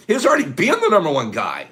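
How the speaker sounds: usual speaking voice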